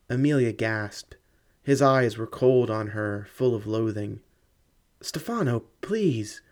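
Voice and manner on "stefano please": high, pleading cry of his name. A narrator's reading. Woman begging her angry fiancé, high pleading cry. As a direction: beg him not to leave, voice lifting. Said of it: "she cried".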